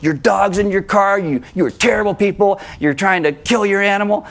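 a hint of a self-righteous stranger scolding; mean note. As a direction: read it like an indignant busybody. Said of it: "writes this mean note".

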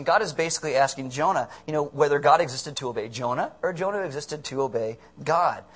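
usual speaking voice

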